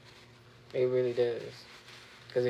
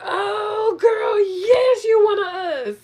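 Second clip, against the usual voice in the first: high, loud, joyful squeal of approval; shrieking with glee at a friend; delighted shout; 11 dB louder, slower than usual. Retold or imitated. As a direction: cry out in happy recognition. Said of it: "and I was like".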